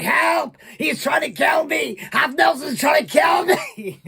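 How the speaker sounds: put-on high, panicked French-accented yell; French-accented shouting. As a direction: imitate a Frenchman screaming for help. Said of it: "in French".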